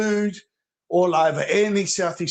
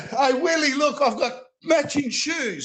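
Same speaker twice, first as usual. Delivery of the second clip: mock taunting; 4 semitones higher, faster than usual. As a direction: rub it in with a put-on voice. Taunting Willie about matching shoes.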